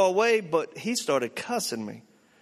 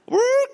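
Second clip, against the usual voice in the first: high, loud imitated police siren whoop; 7 dB louder than usual. Preacher mimics a siren as the car pulls over, loud whoop. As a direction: blast a siren noise with the voice.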